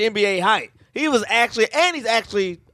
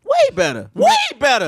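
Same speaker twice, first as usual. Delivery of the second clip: emphatic insistence; 11 semitones higher than usual. Real, not acted. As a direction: stretch it out high, overriding the doubters. Insisting over doubt on dunk claim.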